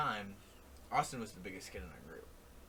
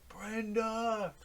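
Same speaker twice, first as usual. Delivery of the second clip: high drawn-out call, moderately loud; imitated holler; 11 semitones higher, 5 dB louder than usual. Drunk kayaker keeps going past the group, hollering. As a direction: mimic the drunk yelling as he passes. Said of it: "screaming at the top of his lungs".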